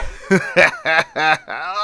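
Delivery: high, gloating evil laugh; acted maniacal laughter; villain's laugh. A narrator's reading. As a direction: laugh in triumph over your victim. Villain laughs over his victim.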